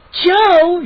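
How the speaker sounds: high, loud shriek. A narrator's reading. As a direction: shriek his name. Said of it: "she shrieked".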